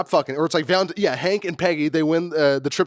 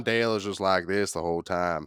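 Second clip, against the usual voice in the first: monotone; 8 semitones lower, 3 dB quieter than usual. Shown as a demonstration. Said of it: "monotone about everything".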